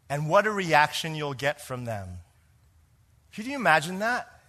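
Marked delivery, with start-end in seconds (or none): none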